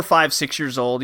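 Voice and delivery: ordinary delivery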